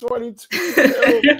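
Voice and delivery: slow, drawn-out words through laughter; helplessly amused, stretching the words; laughing retelling. Retold or imitated. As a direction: crack up while quoting the punchline. Cracking up retelling the joke.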